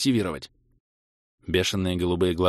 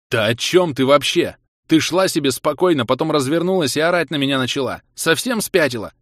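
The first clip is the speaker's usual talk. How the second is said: loud, raised-pitch angry retort; heated, loud protest; indignant shouting; 5 semitones higher, 6 dB louder than usual. A narrator's reading. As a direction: shout back in disbelief. Quarrel, narrator shouts back.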